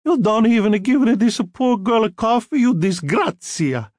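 high, loud Italian scolding; furious, Italian accent. A narrator's reading. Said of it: "turned furiously on Tom Hagen".

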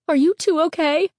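high, concerned woman's voice; lightly voiced anxious mother; worried mother. A narrator's reading. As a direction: check on the kids, pitched high. Mother checks on her frightened boys.

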